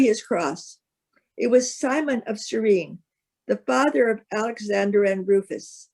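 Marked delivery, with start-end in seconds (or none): none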